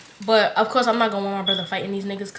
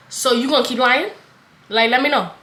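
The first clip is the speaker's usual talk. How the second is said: louder and higher, demanding an answer; angry confrontation; 3 semitones higher, 6 dB louder than usual. Retold or imitated. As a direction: call him out, louder and up. Confronting the lying ex.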